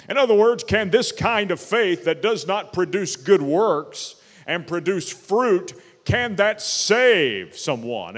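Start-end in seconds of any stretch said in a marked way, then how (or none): none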